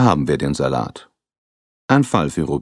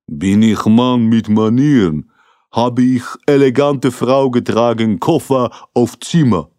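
put-on higher, louder broken German with a Russian accent; Russian-accented Igor; 7 semitones higher, 6 dB louder than usual. Igor answering the detective, indignant in a Russian accent. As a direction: play the offended tough guy in a Russian accent.

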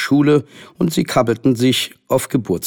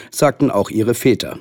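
ordinary delivery, nothing marked

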